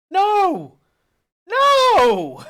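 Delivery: very high, loud outraged no; shocked denial. Acted. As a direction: yelp it in scandalised shock. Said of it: "he looks a little shocked".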